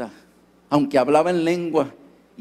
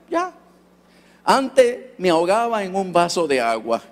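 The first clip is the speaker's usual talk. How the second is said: normal talking voice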